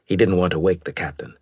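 ordinary delivery, nothing marked

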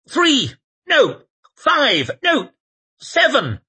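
high, loud rising panic; panicked shouting. Acted. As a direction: count them in a panic. Temple counts pursuers in panic.

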